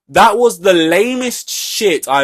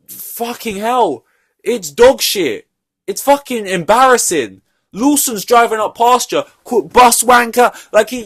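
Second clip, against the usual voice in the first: higher, louder exasperated rant; heated rant; 7 semitones higher, 5 dB louder than usual. Real, not acted. Ranting about Perez's lame race, higher and louder. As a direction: rant at him in disbelief. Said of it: "rant at Perez".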